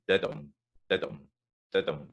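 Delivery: Didum tapped out as short-long beats; didum rhythm. Shown as a demonstration. Teacher chanting the Scots rhythm for singers. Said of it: "So we get that rhythm".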